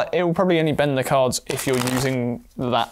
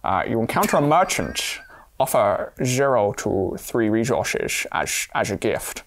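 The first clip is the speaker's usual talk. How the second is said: slow, lower Scottish impression with slurred S sounds; Sean Connery; 5 semitones lower, slower than usual. Player reading an encounter card in character, Scottish film-star impression. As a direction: read it slowly in a low Scottish burr. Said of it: "get into character as Sean Connery".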